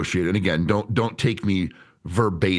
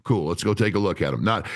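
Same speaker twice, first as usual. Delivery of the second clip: normal talking voice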